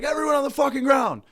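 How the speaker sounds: sudden high furious shout; terrorist yell. Retold or imitated. Comic imagining terrorists storming the plane, screaming high. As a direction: scream the order like a hijacker. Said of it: "terrorists bust in and like".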